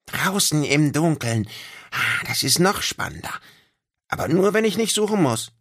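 high, eager little creature squeak; a tiny excited critter pleading to play outside. Acted. Little creature to his friends, high and eager.

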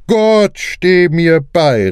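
sudden high exclamation, slowing on the last words; startled cry. A narrator's reading. Clancy startled by what he sees, crying out. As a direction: cry out to God in surprise. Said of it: "rief plötzlich Mister Clancy".

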